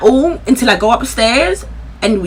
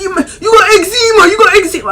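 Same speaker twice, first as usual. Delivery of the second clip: excited shout; 9 semitones higher, 4 dB louder than usual. Retold or imitated. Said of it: "He's like".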